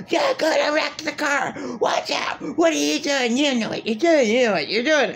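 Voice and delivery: high mock yell at the driver; imitated screaming. Imitating the man screaming at her while she drives, high mock yell. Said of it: "he totally screams at me".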